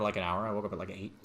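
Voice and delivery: usual speaking voice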